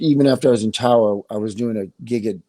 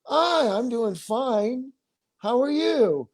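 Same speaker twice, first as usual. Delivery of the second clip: friendly reply; 11 semitones higher, much slower than usual. Retold or imitated. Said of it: "And if they go".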